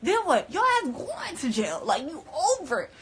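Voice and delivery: high, incredulous laughing warning; retold scolding breaking into a laugh; worked-up retold warning. Acted warning to a friend.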